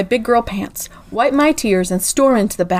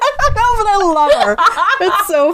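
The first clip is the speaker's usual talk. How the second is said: laughing; 9 semitones higher than usual. Real, not acted. Laughing reply.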